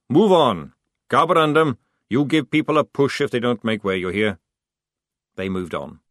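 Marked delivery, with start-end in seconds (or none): 0.1-4.4 loud, firm command; sergeant's order. A narrator's reading. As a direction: bark orders down the line.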